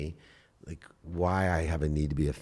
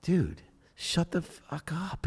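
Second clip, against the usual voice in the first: high, slow, mocking self-rebuke; inner voice scolding himself; 12 semitones higher, slower than usual. Telling himself off mid-argument with his wife, high mock scold. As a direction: snap at yourself inside your head. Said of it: "I'm thinking while I'm doing it, going".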